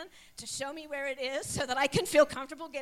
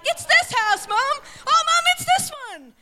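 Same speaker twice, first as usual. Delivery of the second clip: put-on high, loud kids' voices teasing mom; teasing kids; 14 semitones higher, 15 dB louder than usual. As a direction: play the boys in the back teasing their mom, high and loud. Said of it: "they think it's a hoot".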